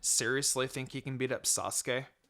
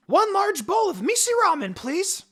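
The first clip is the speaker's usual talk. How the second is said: very high, loud eager shout; excited kid order; 9 dB louder than usual. A narrator's reading. Naruto ordering his favourite ramen, very high excited shout. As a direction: order like a starving happy boy.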